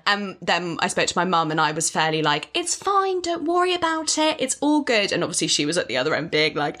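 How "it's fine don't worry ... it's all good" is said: high, airy, breezy brush-off; breezy reassurance. Retold or imitated. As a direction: reassure her lightly, pitched up high. Breezily reassuring mum on phone.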